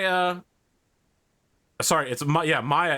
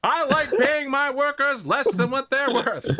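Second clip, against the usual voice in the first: smug businessman; 11 semitones higher than usual. Acted. NPC businessman boasting.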